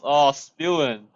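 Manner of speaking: ordinary delivery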